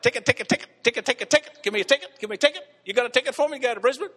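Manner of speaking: high, rapid-fire ticket-begging call; mock yelling. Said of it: "yell out".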